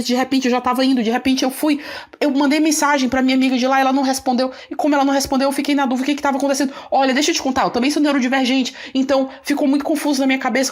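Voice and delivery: a rushed nonstop babble without pauses; no commas, no breath. Retold or imitated. To the teacher she left, flustered and nonstop without commas. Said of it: "comecei a falar no modo sem vírgulas".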